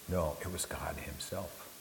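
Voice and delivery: hushed whisper of awe. Real, not acted. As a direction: drop to a breath for the answer. Quiet climax after rhetorical questions.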